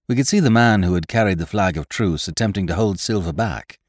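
ordinary delivery

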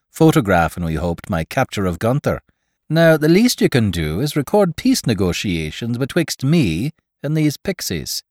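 playfully bossy Irish tough guy; swaggering Irishman. Acted. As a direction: order it with mock grandeur. Irishman theatrically orders a photo.

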